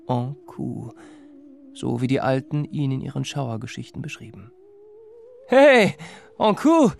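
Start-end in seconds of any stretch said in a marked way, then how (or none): 5.5-6.9 high, loud call, faking courage; brave-sounding hail to the stranger; forced bold voice. Acted. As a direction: shout it to hide the fear. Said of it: "mit einer ... furchtlosen und festen Stimme".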